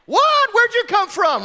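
high, loud startled yelp; startled disciples. Retold or imitated. Disciples caught off guard by Jesus appearing, startled shout. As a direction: jump out of your skin in surprise.

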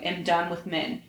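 plain reading voice

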